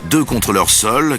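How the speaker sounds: ordinary talk, nothing marked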